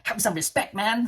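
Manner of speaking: imitating an annoyed officer laying down the law; lightly voiced angry policeman; stern scolding. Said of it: "na nagalit siya sa akin".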